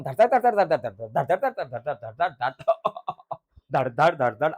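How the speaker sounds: rapid-fire "dhad" thunderclaps, bursting loud then dropping; imitated thunder rumble. Teacher telling students of the storm, imitated thunderclaps. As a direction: imitate rolling thunder with repeated thuds. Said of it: "ढगांचा कडकडाट एकदम".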